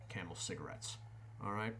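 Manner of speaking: ordinary talk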